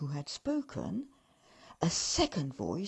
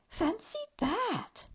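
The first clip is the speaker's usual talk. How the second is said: much higher, slow awed elf; 13 semitones higher, slower than usual. Acted. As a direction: gasp it in wonder.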